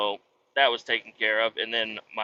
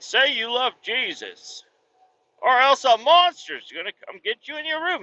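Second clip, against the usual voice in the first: mock video voice; 11 semitones higher, 3 dB louder than usual. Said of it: "It's like".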